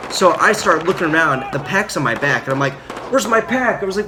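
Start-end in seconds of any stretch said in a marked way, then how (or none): 3.1-3.8 high, agitated retold question; imitating a frantic search, pitched up high; urgent demand. Heat-exhausted marine hunting for his pack, high urgent demand.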